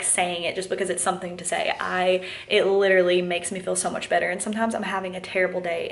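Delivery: usual speaking voice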